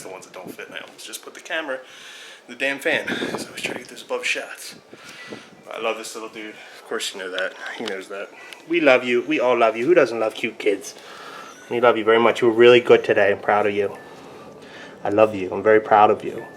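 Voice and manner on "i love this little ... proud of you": low, soft bedtime reassurance; real low-voiced affection; tender. Real, not acted. Bedtime affection to his child.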